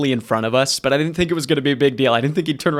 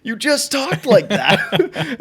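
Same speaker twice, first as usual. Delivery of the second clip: tipsy laughing taunt; 10 semitones higher, much slower than usual. Retold or imitated. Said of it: "the confidence to be like".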